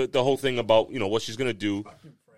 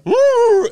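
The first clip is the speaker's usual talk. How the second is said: high, loud imitated yelp; imitated howl of pain; 8 dB louder than usual. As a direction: mimic the dog's cry, loud and high. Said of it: "I hear".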